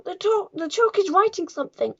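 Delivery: high, loud schoolboy shriek; screaming. A narrator's reading. Nigel points class to writing chalk, screaming. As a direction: cry out at something impossible. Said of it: "screamed Nigel".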